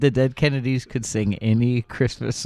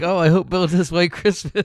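excited; 8 semitones higher, 5 dB louder than usual. Retold or imitated. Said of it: "I was like".

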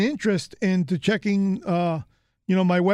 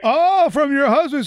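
surprised realization; 7 semitones higher, 6 dB louder than usual. Real, not acted. Realizing the name is her husband's.

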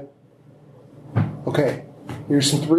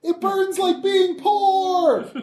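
hammed-up pained yell; much slower than usual. Character burning, joking.

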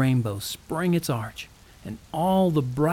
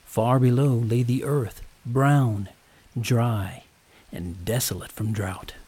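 ordinary delivery, nothing marked

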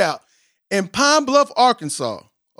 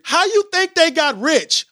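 higher pitch, pressing the question "How you think they got rich?"; emphatic rhetorical question; 8 semitones higher than usual. Shown as a demonstration. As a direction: punch it like the lyric. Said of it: "dependency theory".

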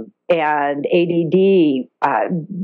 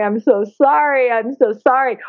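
mild mock crying, slightly raised pitch; retold tearful apology; 5 semitones higher than usual. Woman crying to a captive dolphin, retold tearful apology. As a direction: lightly act out a weepy apology. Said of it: "I'm crying and going".

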